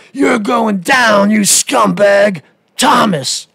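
hammed-up raging caller, slow and shouted at the end; mock furious rant. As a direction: yell the threats in a shrill, over-the-top parody. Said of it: "that's the way he talks to you".

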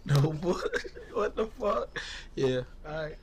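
raspy, worn-out lost voice; hoarse voice. Real, not acted. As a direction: croak it out with a hoarse voice. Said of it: "I lost my voice".

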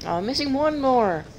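normal talking voice

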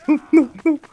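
high, fast panicked cry. Real, not acted. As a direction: scramble away in real panic. Panicking as seeker appears.

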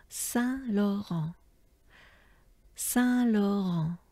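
Saint Laurent said slowly as a whole; slow model. Shown as a demonstration. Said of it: "as a whole".

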